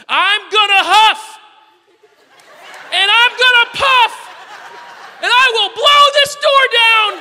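put-on high, slow, full-force toddler roar; screaming, yelling child. Confident daughter threatens the door like the wolf, screaming. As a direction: bellow each threat like a raging three-year-old. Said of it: "She's screaming, she's yelling, and then she goes".

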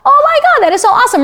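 very high, delighted squeal; thrilled wonder. Retold or imitated. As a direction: gush with sudden joy. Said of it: "And I was like".

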